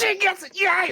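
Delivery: high, loud happy yell; delighted cheer; excited bugbear. Acted. Bugbear cook cheers at the player's awkward smile, loud high cheer. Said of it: "and he's like".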